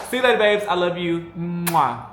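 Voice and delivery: high, loud loving goodbye with a kiss; loud sign-off. Real, not acted.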